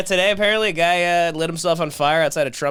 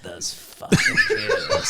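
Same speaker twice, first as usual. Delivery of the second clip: mock bigot; 3 dB quieter, much slower than usual. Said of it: "they're like".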